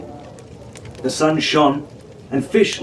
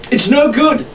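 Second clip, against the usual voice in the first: shouted; 9 semitones higher than usual. A narrator's reading. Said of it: "Fitzwilliam shouted".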